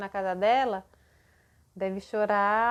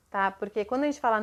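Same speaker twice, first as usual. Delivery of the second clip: ordinary talk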